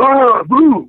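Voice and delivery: a hammed-up giant springing a scare; giant's mock boo. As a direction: jump out and yell boo like Andre. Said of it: "he's been yelling, go".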